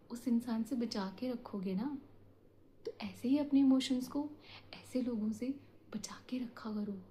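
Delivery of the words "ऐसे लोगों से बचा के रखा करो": whisper. Real, not acted. Whispered advice.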